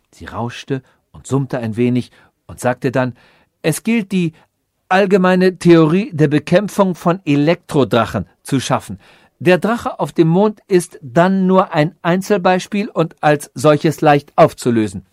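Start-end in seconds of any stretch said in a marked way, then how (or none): 3.6-15.0 lightly voiced know-it-all computer, a bit raised; a hint of an expounding machine; lecturing machine. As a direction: pronounce it like a mildly pompous calculating machine.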